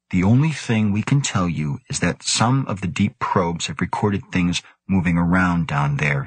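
ordinary delivery

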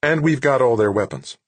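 upbeat and relieved; excited report. A narrator's reading. Anson reporting success.

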